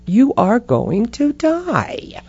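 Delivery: high, drawn-out mock pronouncement; little inner voice. Little voice in her head answering the frightened patient, drawn-out mock pronouncement. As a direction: stretch the last phrase out mockingly high. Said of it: "The voice goes".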